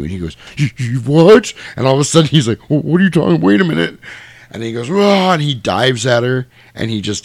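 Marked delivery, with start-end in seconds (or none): 0.6-4.0 higher, louder, stammering protest; mock panic, pitch climbing; flustered villain. Voices Freddy losing his power. 4.9-5.3 high, loud "whoa" roar; mock villain cry on the attack; lunging yell. Voices Freddy diving at her.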